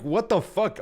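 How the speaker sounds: high disbelieving what the fuck; boy baffled by dad's alpaca plan, high mock surprise; shocked kid. Kid reacting to alpaca idea.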